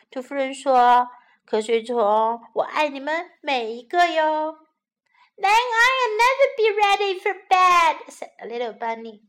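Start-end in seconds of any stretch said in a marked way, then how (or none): 5.4-8.1 put-on high, loud cheeky child; child defiant. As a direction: tease mum like a cheeky kid.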